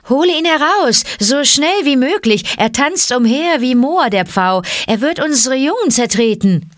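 high, agitated cobra voice; shrieky, urgent snake complaint; cobras calling out. A narrator's reading. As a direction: cry out in alarm for your young. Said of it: "riefen die Kobras".

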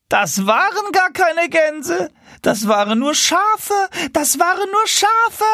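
high, slow mocking goose voice; teasing. Wild geese mocking the tame geese, slow high teasing. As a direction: jeer at them with relish.

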